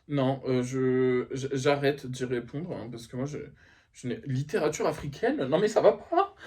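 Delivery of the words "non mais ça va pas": indignant. Acted. Indignant at the group suggestion.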